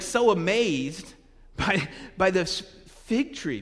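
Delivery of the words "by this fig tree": ordinary talk, nothing marked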